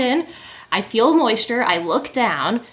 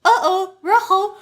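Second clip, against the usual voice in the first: broken Spanish; 8 semitones higher, slower than usual. Retold or imitated. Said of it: "I'm like".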